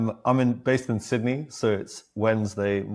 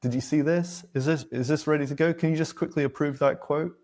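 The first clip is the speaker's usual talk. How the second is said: higher, pestering coworker voice; lightly voiced impatient colleague, pitched up; higher mock nag asking for a quick approval; mock Slack nagging; 6 semitones higher than usual. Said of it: "messages on Slack".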